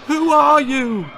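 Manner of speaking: high, loud frightened cry; terrified gasp. A narrator's reading. Terrified boy facing the monsters, gasping in fear. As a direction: gasp it out in terror. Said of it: "gasped the terrified boy".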